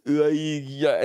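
lightly imitated drunken babble; a hint of slurred, stubborn drunk; mock drunk. Friend imitating the very drunk, mock drunk mumble. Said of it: "so drunk that they just go".

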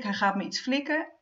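ordinary talk, nothing marked

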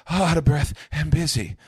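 high, loud put-on breathless voice; hammed-up busy complaint; mock breathless busy. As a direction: puff it out like you're swamped. Imitates busy listener.

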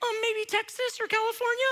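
put-on very high kid voice, guessing; imitated fifth grader. Student guessing the teacher's home state, imitated fifth grader. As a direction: guess tentatively in a put-on child falsetto. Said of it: "my best imitation of a fifth grader".

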